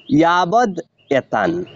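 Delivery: "ইয়াবদ এতান" read slowly as two separate words; split reading. Shown as a demonstration. Teacher showing how to split the words, slow and careful. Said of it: "এটিকে আমরা এভাবে পড়ব".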